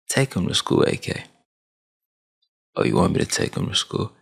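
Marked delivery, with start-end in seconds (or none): none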